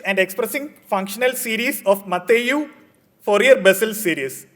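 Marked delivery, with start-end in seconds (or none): none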